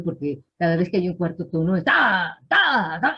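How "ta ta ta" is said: imitating beginners' loud, cross "ta"; mock angry tone. Teacher imitates foreign beginners speaking Chinese, mock angry. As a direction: hammer out "ta" like an over-forceful learner. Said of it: "muy enojado".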